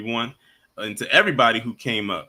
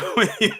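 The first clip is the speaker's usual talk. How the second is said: short laugh; 9 semitones higher, 3 dB louder than usual. Real, not acted. Laughs before playing outro.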